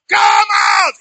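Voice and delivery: very high, loud, drawn-out yell; full-voiced mock command; demonstrated shout. Shown as a demonstration. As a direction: shout it as if to wake the dead. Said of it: "If he like shout".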